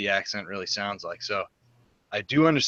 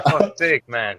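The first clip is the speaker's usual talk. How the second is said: louder mock Irish accent; 4 semitones higher, 7 dB louder than usual. [name] repeating the phrase for a puzzled Brian, louder mock Irish accent. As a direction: shout "For fuck's sake" in a hammed-up Irish accent, higher and louder. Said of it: "I said".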